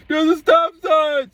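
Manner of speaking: high, drawn-out mock yell; loud, high-pitched holler, stretched out; imitated shouting. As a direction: mimic a man yelling from down the street. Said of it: "he's shouting".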